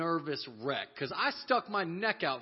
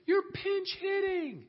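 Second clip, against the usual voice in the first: high, slow called-out order; coach's call; slower than usual. Retold or imitated. Coach tells the player he's up, high and slow. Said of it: "and he says".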